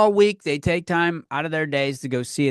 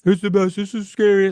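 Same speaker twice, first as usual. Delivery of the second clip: higher and louder, gushing mockery; mock hype; 7 semitones higher, 6 dB louder than usual. Scene: mocking people who hyped the movie, gushing. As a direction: imitate overexcited fans, hammed-up. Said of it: "said it was the best movie".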